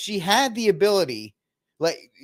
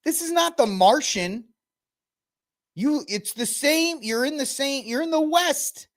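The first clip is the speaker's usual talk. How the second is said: high-pitched exasperated argument; incredulous protest; 10 semitones higher than usual. Real, not acted. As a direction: argue in disbelief, voice pitched way up. Incredulous rebuttal to the clip.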